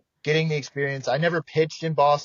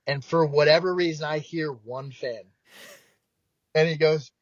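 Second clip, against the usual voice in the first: ordinary talk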